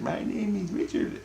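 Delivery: put-on a tiny faraway child says its name; innocence voice. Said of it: "my innocence is talking".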